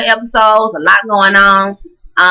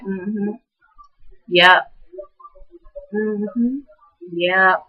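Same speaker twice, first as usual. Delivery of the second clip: softer, flat mm-hmms with eyes fixed ahead; absent-minded agreeing; 7 dB quieter, much slower than usual. Retold or imitated. Phaedra ignoring the man in her ear, absent mm-hmm. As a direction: humor him without turning your head. Listening while staring straight ahead.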